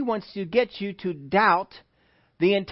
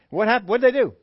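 usual speaking voice

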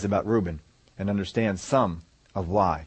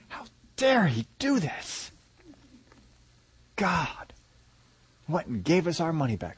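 mock frightened brothers; 4 semitones higher, 4 dB quieter than usual.

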